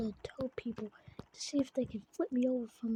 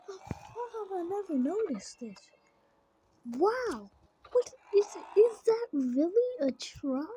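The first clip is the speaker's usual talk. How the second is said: amazed; 6 semitones higher, 4 dB louder than usual. Real, not acted. Finding an old farm truck.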